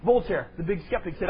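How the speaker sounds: ordinary talk, nothing marked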